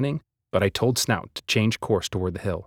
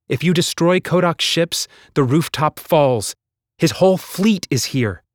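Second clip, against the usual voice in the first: raised, high calling voice; urgent call; 7 semitones higher, 3 dB louder than usual. A narrator's reading. Hazel calling to everyone amid chaos, urgent raised voice. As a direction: shout it across the battlefield.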